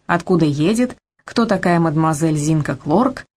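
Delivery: plain reading voice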